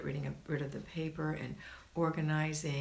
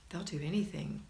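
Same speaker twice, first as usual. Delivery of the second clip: ordinary talk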